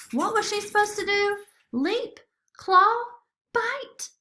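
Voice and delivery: high, frantic flurry of questions; rising squeaks of panic; panicky questions. Acted.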